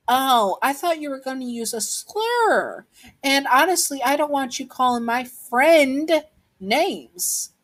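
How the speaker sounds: high-pitched, mock-innocent comeback; acted child standing up to a bully; sassy retort. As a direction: answer back high and pointed, defending a friend. Child retorts after throwing rock.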